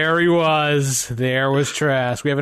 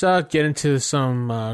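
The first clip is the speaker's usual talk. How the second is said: ordinary delivery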